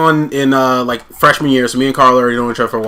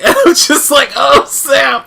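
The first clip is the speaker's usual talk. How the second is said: laughing; 13 semitones higher, 5 dB louder, slower than usual. Real, not acted. Laughing while retelling second call.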